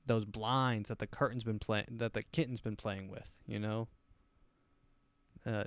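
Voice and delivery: normal talking voice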